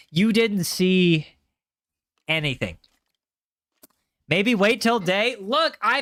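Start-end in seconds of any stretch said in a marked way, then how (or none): 0.1-2.8 stretched out, slow and deliberate; slow mock-serious you-didn't-see-that; slow drawn-out denial. Shown as a demonstration. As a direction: drag each word out slowly. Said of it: "repeats slowly, drawn out".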